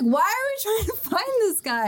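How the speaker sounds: high-pitched mock complaint; exasperated retelling. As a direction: whine it like a baffled crewmate. Acting out Zoro complaining about searching.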